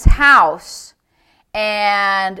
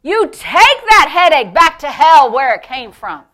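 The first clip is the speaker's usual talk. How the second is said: loud, raised, emphatic sermon delivery; full-force preaching; 4 semitones higher, 7 dB louder than usual. Real, not acted. Preacher urges believers to refuse sickness, fervently loud. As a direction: drive each phrase home to the congregation.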